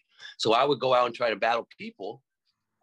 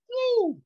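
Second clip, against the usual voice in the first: sudden high "woo"; high awed whoop; 4 dB louder than usual. Retold or imitated. Kid watching the dancers' moves, high retold whoop. His awed reaction to the dancing.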